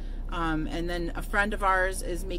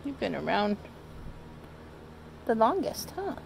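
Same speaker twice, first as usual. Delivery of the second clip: high cooing voice for the cat; doting owner baby-talking her old cat; pet baby talk; 3 semitones higher than usual. Acted.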